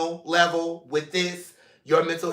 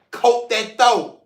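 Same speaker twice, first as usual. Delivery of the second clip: playful rhyme; 5 semitones higher, 5 dB louder, slower than usual. Real, not acted. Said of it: "I made myself laugh with that one".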